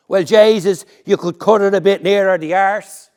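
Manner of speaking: cheeky Dublin woman. Acted. Woman retorts.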